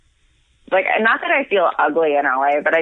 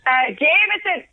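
Irish pub order; 11 semitones higher than usual. Shown as a demonstration. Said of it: "the Irish yelling order".

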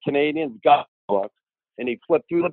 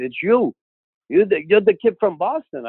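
higher exclamation of sudden recognition; retold astonished oath; 5 semitones higher than usual. Star recognizes the kid at training camp, astonished. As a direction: imitate a startled curse.

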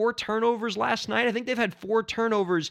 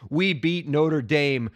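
usual speaking voice

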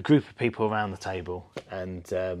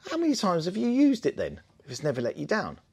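much higher, louder retort; mocking bystander; 11 semitones higher, 4 dB louder than usual. Man at the table challenging the caravan owner, mock voice. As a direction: pitch it up as a pointed challenge. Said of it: "he turned around and goes".

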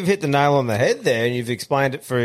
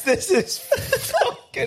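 laughing hard; much slower than usual. Real, not acted. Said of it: "Oh, nice".

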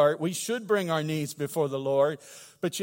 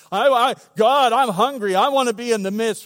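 loud, raised-pitch plea to God; fervent cry; 5 semitones higher, 8 dB louder than usual. Acted. Preacher urging the church to want the promise, fervent cry. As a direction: cry out hungrily to the Lord.

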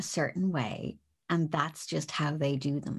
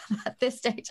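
ordinary talk, nothing marked

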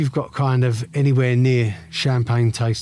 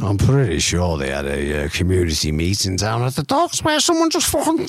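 put-on Liverpool accent rising higher; lightly voiced Scouse storyteller; 6 semitones higher than usual. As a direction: tell it like a Scouser. Continues Scouse impression.

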